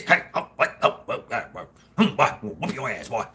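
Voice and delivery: a lightly voiced cranky old Southern great-grandpa; half-laughing mock of a crusty old grandpa; gruff great-grandpappy. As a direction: bark it like a grumpy old farmer scolding the boy. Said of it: "Great-grandpappy".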